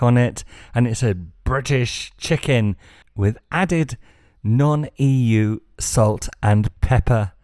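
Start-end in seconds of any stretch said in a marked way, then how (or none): 1.4-7.3 mock reading. Reading a jingoistic label mockingly.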